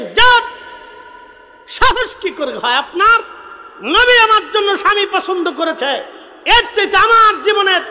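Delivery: very high, loud preaching at full force; impassioned shouted rebuke. Acted. Daughter tells parents the Prophet chose her husband, impassioned shout. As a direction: hurl each phrase out high and hard, with pauses between.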